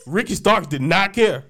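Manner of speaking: normal talking voice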